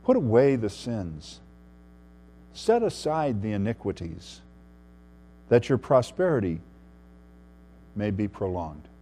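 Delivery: soft, meek counsel with long pauses; soft-spoken and deferential, falling low at the end; gracious, meek appeal. Acted. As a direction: gently encourage the king, hushed and unhurried. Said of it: "very graciously and very meekly, Daniel says".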